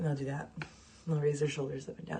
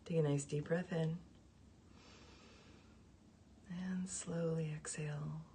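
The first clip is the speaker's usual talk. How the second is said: soft, slow meditation voice with long pauses; guided meditation; 15 dB quieter than usual. Real, not acted. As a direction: guide the breathing gently and quietly, drawing out each exhale. Leads cleansing breaths.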